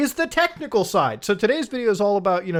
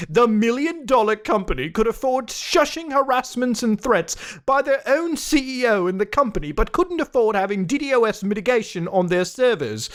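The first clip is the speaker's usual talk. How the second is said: higher, nose-in-the-air snob voice reading the tweet; snooty put-on accent; 4 semitones higher than usual. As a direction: sneer it out like a smug know-it-all expert. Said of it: "sounded like somebody had their nose up their asshole".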